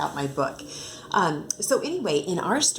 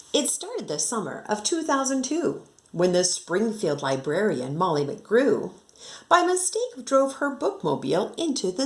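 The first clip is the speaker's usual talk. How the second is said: lively singsong storybook reading; reading to children; 3 semitones higher than usual. Acted. Teacher reading a rhyming picture book to her class, lively and singsong. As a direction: bounce the rhyme for young listeners.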